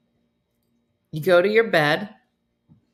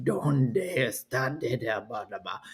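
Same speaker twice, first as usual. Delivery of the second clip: wife's man voice; 7 semitones lower, 7 dB quieter than usual. Retold or imitated. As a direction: mimic a woman putting on the creepy lesson man. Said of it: "The wife is doing the man voice".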